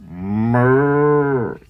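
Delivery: long, drawn-out cow moo. Acted. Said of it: "The cow says".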